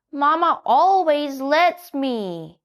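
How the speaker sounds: put-on child donkey wheedling; Little Donkey. A narrator's reading. Donkey asks the hen for fries, high and slow. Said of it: "said Little Donkey".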